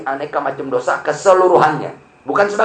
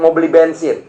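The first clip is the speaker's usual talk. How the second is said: ordinary talk, nothing marked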